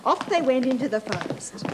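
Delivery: ordinary delivery, nothing marked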